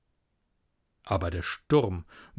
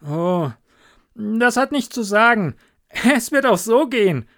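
much higher and louder; cheerful call; 9 semitones higher, 6 dB louder than usual. Acted. Hans brushing off the miller's refusal, high loud call. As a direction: wave the worry away brightly. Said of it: "rief der kleine Hans".

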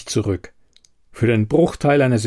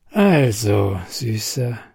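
drawn out; slower than usual. A narrator's reading. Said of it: "sagte sie gedehnt".

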